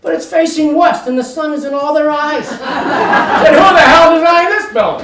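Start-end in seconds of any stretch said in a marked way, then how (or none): 2.5-5.0 high, loud and slow, laughing through it; laughing indignation. Retold or imitated. Laughing at the architect, high loud mock outrage. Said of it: "retold thought, laughing".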